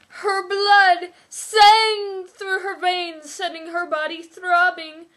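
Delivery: slow, high, loud swooning narration; overwrought reading. Acted. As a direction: read it like a breathless romance novel. Dramatic reading of a steamy passage.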